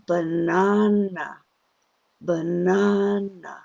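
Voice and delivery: banana with the middle syllable drawn out long; stretched stress. Shown as a demonstration. Teacher pulling a rubber band on the stress, lengthened. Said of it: "pull it on the stressed syllable".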